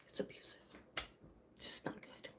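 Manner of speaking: breathy near-silent whisper; soft private whisper. Real, not acted. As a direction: barely voice it, hushed and heavy. Drops to a whisper on abuse.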